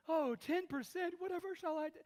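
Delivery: high, prissy mock whine; mock a stingy giver fussing over ten percent; mock fretting.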